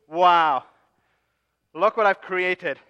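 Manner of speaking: loud, slow, proud showing-off; admiring his own golden calf, loud and slow; proud wonder. Acted. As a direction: present the calf like a prize. Aaron marvels at golden calf.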